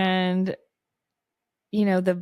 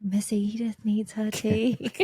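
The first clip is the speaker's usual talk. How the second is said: whispered, childlike mock Drusilla; loopy Drusilla whisper; 2 semitones higher than usual. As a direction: whisper it like a dotty girl to her doll.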